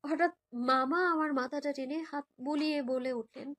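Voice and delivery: plain reading voice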